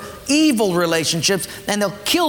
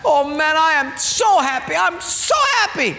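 high, repeated so happy, rising giddier; giddy delight; 8 semitones higher than usual. Acted. The man given a closet of gold gushes, giddy and high. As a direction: bubble over like a lottery winner. Acted rich gift recipient.

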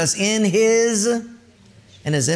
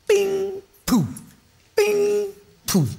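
popping sound effects; much slower than usual. Retold or imitated. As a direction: pop the stakes out one by one. Stakes popping out.

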